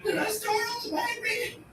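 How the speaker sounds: very high, soft sarcastic coo; mocking baby talk. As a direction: feign concern in a baby-talk voice. Sarcastic retort to dog owner.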